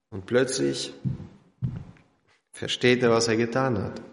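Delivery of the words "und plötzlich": ordinary delivery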